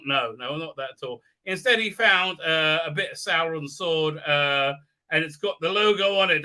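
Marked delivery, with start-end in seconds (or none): none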